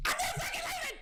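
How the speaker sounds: very high, defiant yell; Leonardo DiCaprio. Acted. Streamer quoting a film to chat, defiant yell. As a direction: scream a defiant film quote. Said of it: "just like Leonardo DiCaprio".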